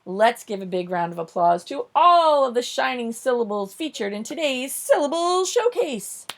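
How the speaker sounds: rally applause like a showcase host; show host. Pretend. Host asks a round of applause for the syllables, building announcer voice.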